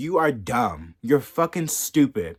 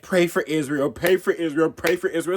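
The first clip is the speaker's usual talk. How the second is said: mocking pious slogan; 4 semitones higher, 4 dB louder than usual. Mocking the governors' posts, a sneering mock slogan. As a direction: repeat it as a sneering, sing-song mock prayer, a touch higher. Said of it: "They're like".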